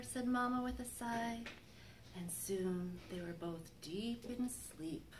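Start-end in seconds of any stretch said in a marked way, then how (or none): 2.1-5.1 slow, sinking into a hush; fading to a whisper. A narrator's reading. Reading to children, trailing off into a whisper. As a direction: let the story fall asleep. Story ending in sleep.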